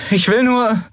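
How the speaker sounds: put-on pleading young man. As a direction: gently coax someone out of hiding. Kai reassures stranger.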